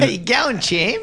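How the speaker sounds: high, loud matey greeting; matey uncle voice. Retold or imitated. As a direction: play the hearty uncle. Co-host mimics matey greeting.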